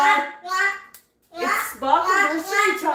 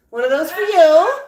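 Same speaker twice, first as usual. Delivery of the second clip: upbeat call; 9 dB louder than usual. Real, not acted. Handing treats to the birds.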